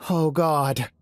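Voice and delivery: high, startled cry of alarm; shocked cry. Acted. Injured guard gasps at the creature in fright. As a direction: blurt it out in horror. Cyphers cries out at attack.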